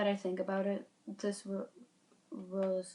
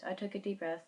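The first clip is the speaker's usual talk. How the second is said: usual speaking voice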